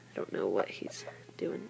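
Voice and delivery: hushed aside about the dog; whisper. Real, not acted. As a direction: breathe it out, bemused. Puzzled at dog among chicks.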